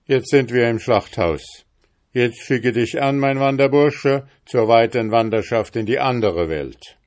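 a cruel innkeeper taunting his victim; menacing innkeeper. A narrator's reading. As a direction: savour the threat of the butcher's hall. Innkeeper threatens the journeyman.

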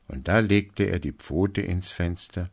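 ordinary delivery

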